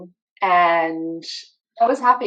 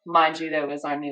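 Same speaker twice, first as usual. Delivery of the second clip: ordinary delivery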